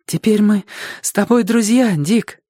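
high, gentle character voice; high-pitched young companion; boy companion. A narrator's reading. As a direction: offer friendship in a high youthful voice. Companion's voice in narrated dialogue.

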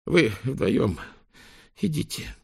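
out of breath, gasping; breathless and winded; gasping for air. Acted. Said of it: "задыхаясь".

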